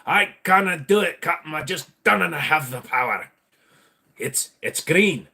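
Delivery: a hammed-up Scottish engineer telling the captain no; Montgomery Scott. Scotty telling the captain there's no power, Scottish brogue. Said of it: "I'm more like the old Montgomery Scott".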